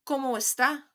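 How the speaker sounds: spoken model for the class; model for repeating. Shown as a demonstration. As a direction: model "¿Cómo está?" for students to echo. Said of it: "Repeat after me".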